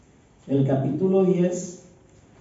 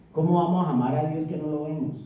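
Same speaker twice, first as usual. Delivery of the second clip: ordinary talk, nothing marked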